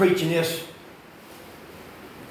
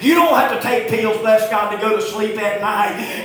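loud, raised country-preacher cadence; full-force preaching; 5 semitones higher, 5 dB louder than usual. Real, not acted. Preacher exhorting congregation about hard work, full-force preaching. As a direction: preach it hard, punching key words.

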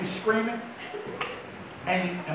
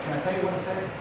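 usual speaking voice

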